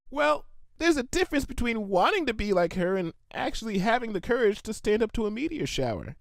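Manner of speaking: a high, admiring defense; high pegasus voice, a bit unsure, defending her hero; Shimmer's voice. A narrator's reading. Shimmer defends Rainbow Dash.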